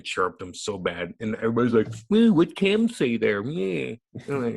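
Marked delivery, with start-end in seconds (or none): 2.1-3.9 high, loud, drawn-out mocking whine; mock scandalized onlookers. Guest imitates onlookers reacting, high mocking voice. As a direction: mimic fussy tattlers, slow and high. Mimics everybody reacting to his chirping.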